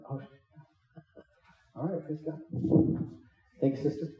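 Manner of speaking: soft, amused, half-laughing aside; mock hushed reply. Jesus answering the sister, soft and amused. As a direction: toss it off quietly with a chuckle.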